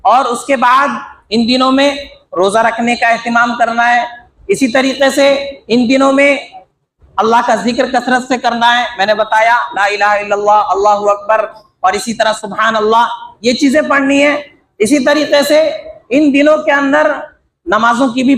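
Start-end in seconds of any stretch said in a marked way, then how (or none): none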